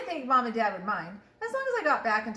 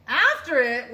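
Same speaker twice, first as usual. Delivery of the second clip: ordinary delivery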